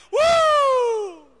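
high loud whoop; mock worship whoop, high and loud; shout of joy. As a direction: let out a jubilant whoop. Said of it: "Grito de júbilo".

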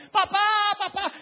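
grito muy agudo y fuerte; llama desgarrado a papá; grito de niño. Acted. Isaac llama a su padre. (Isaac en el altar llama a su padre, gritando)

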